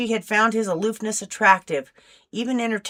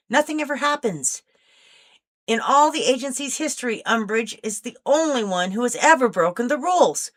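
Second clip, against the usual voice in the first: put-on higher, eager voice of a restless young agent; 6 semitones higher, 4 dB louder than usual. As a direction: let her frustration with dull work push the pitch up. Catalina's long restless speech.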